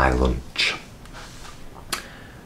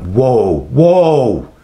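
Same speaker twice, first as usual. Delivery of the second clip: raised, building protest; indignant rebuke; 8 semitones higher, 4 dB louder, much slower than usual. Retold or imitated. Carer rebukes shouting CEO, loud indignant retort. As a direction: cut them off with rising outrage, re-enacted.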